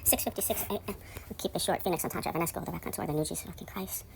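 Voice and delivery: soft, half-whispered date and playlist; hushed intro. Real, not acted. Hushed early-morning recording intro, half-whispered. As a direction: murmur it so nobody wakes.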